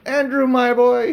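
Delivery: high, loud and slow, hammed-up; pompous self-address. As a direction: puff yourself up at the mirror. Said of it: "he said to himself".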